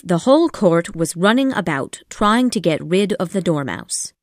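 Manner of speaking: ordinary delivery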